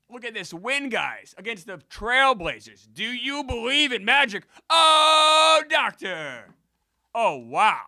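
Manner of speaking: high, loud catchphrase holler; excited victory shout. Real, not acted. Streamer celebrating a win against the Trailblazers, ecstatic shouting. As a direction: build up and belt out the celebration.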